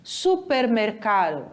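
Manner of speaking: Supermercado with the final ado nearly swallowed; Supermercado, blur the ending ado together; Spanish pronunciation. Shown as a demonstration. Teacher showing students how Spanish sounds. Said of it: "Olha como é que fica".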